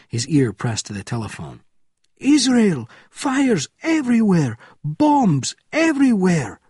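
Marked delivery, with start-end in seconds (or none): 2.2-6.6 very high, loud cries of alarm; panicked scream. A narrator's reading. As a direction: scream each report in panic. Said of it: "he screamed".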